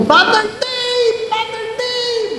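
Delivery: high, slow, drawn-out call from afar. Retold or imitated. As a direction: call his name from behind bars, high and stretched. Prisoner calling out to priest.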